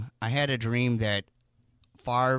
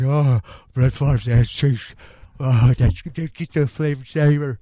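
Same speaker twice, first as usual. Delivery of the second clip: mock swooning; 9 dB louder than usual. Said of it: "She's like".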